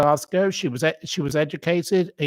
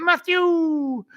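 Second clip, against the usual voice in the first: much higher delighted mock call of the name; Lorraine Kelly calling out; 12 semitones higher than usual. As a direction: sing out the name like an old friend. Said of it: "And she went".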